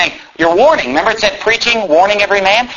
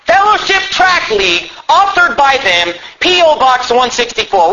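naming names; 7 semitones higher than usual. Real, not acted. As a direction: call it out for everyone to hear. Calls out the publisher's name, address.

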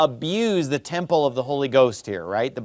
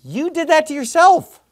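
sharp rebuke; 10 semitones higher, 4 dB louder than usual. Real, not acted.

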